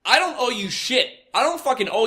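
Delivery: high mock-furious outburst; imitating livid retort. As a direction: snap back at the manager, pitched high. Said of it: "I'm like".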